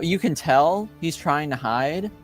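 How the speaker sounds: ordinary talk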